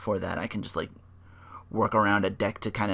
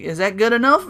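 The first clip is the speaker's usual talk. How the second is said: mock gruff Lesnar; 11 semitones higher, 6 dB louder than usual. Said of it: "it's like".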